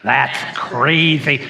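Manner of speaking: slow lisp on "That's crazy"; lisped catchphrase. Shown as a demonstration. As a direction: lean into the lisp, slowly. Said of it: "So you try that with me".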